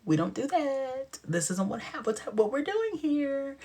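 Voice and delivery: high, prim scolding voice; scolding sister. Retold or imitated. Sister scolding Mitzi, high and prim. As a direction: chide her like a proper sister.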